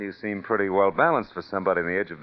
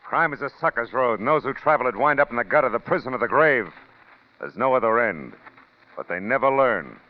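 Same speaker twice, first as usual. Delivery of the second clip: terse, hard warning to the audience; stern narration; 4 semitones higher than usual. Acted. Narrator warning listeners that crime never pays, stern and terse. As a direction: lay down the law about crime.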